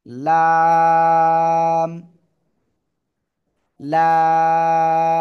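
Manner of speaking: laam held long, loud and steady; drawn-out laam. Shown as a demonstration. Teacher to online class, demonstrating the letter, long loud drawn-out laam. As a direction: sustain লাম for several beats, loud and even. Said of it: "সবাই লাম অক্ষরটা উচ্চারণ করতে হবে".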